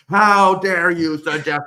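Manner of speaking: loud, slow indignant boom; slow, loud mock outrage; outraged lawyer. Angry lawyer voiced.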